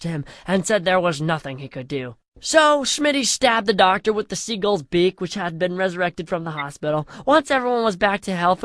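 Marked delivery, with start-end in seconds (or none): none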